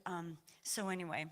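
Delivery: ordinary talk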